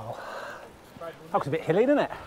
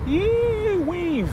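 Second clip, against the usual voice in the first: excited whoop; 14 semitones higher than usual. Real, not acted. Whooping while riding downhill.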